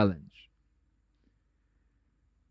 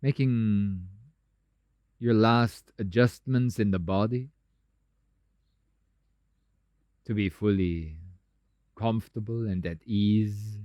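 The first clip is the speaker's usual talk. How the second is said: soft, lowered meditation voice with long pauses; guided meditation; 5 semitones lower, 5 dB quieter than usual. Acted. Teacher settling meditators into a stable pose, calm guided meditation. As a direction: ease them down gently, softer and lower, leaving space.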